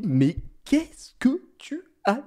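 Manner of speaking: slow whispered disbelief; a slow, whispered disbelieving question to his wife; stunned whisper. Retold or imitated. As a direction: breathe it out, baffled.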